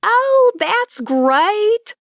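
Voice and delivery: high, loud, delighted Southern exclamation. Acted. Neighbor thrilled to hear of a son, delighted Southern gush.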